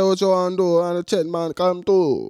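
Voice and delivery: high, loud mock Jamaican patois; imitating Chet's old Jamaican voice; mock Jamaican accent. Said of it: "He said".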